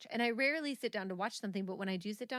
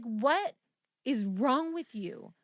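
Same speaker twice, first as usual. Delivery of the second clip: slow, loud, drawn-out disbelief; exasperated demand; 3 semitones higher, 6 dB louder, much slower than usual. Retold or imitated. Confronting a friend who laughs mid-argument, exasperated. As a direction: retell an exasperated demand, stretching each word.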